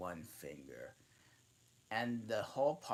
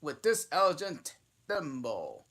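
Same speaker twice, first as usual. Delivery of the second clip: higher, louder mock-ceremonial speech; solemn pompous dodo; 6 semitones higher, 7 dB louder than usual. A narrator's reading. Dodo solemnly presents Alice the thimble, mock ceremony.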